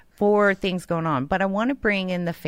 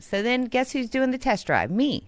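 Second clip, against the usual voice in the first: ordinary delivery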